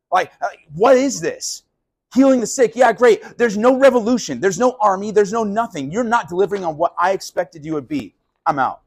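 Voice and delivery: sarcastic angry tirade; bitter rant. Acted. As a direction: vent your disappointment and storm off. Judas rant quitting Jesus.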